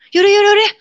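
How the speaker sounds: loud, high surrender; exasperated. Retold or imitated. Woman haunted daily gives in, exasperated. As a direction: give in with a fed-up high burst.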